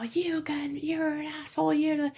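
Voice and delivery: high, breathy mock rage at the listener; mock angry insult. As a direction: imitate someone lashing out, but hushed. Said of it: "if someone's like".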